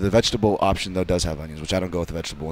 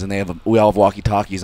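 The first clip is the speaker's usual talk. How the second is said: ordinary talk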